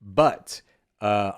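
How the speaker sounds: ordinary delivery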